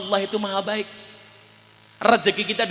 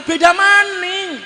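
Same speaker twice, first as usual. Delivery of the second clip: high, loud and slow comic wail; rising comic shout; comic exclamation; 9 semitones higher, 6 dB louder, slower than usual. Acted. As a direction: stretch it out in high mock amazement. Comic buildup to older woman's fart.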